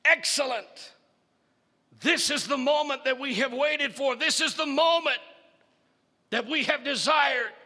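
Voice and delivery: high, exultant mad scientist; mad doctor. Acted.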